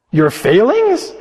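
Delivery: slow, disbelieving echo; astonished question. Acted. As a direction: repeat it as if you can't believe it.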